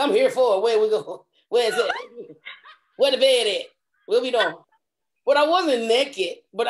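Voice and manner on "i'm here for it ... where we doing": mock eagerness. Re-enacts eager reply to actor.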